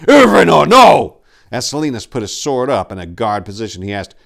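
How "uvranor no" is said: loud high shout. Acted. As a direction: shout to stop him. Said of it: "Tor shouted".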